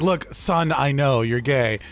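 hammed-up knowing father; mock dad. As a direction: play the dad who always knew. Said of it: "because it's like".

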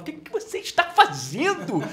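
high, loud, laughing question; laughing disbelief. Retold or imitated. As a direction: blurt it out through laughter. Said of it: "a gente se olhava e falava".

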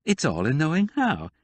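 lightly voiced smug expert; Rosemary's voice. A narrator's reading. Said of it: "she said".

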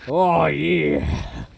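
drawn-out cowboy drawl; cowboy accent. Acted. Joking along in cowboy accent, stretched drawl. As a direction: agree like a caricature cowboy. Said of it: "cowboy accent".

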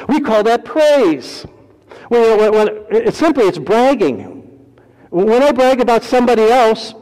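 fervent sermon; preaching. Acted. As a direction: drive it home to the congregation. Preaching at full force.

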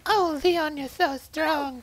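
very high put-on falsetto; mock damsel voice. Ashley swooning over Leon, mock falsetto.

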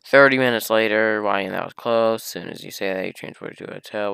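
ordinary talk, nothing marked

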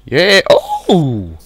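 high, loud burst of excitement; loud excited yelp. Real, not acted. Gamer fighting a mob, loud excited yelps. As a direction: cheer as the hit lands.

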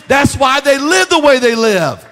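loud, rising preacher's cry; full-force preaching. Real, not acted. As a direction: build the sermon to a loud peak.

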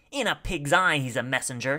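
loud, scornful retort; shouted. A narrator's reading. Second man rejects the messenger story, shouted. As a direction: throw it back at full projection. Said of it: "shouted another".

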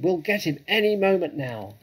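high, loud call to partners; shouted. A narrator's reading. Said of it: "shouted to Bunce and Bean".

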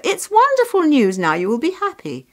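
high, loud, delighted neighbour; elated villager's exclamation; joyful congratulations. A narrator's reading. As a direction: gush over the good news, soaring then settling. Villagers announce good news to mother.

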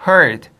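heard as one syllable, "hurd"; correct pronunciation of heard. Shown as a demonstration. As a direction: model heard with an "er" vowel. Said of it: "이거 heard 아니야".

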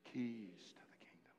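hushed whisper of the big reveal. Acted. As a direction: breathe it like a secret. Revealing climax of the verse.

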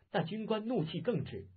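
plain reading voice

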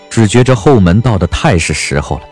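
ordinary delivery

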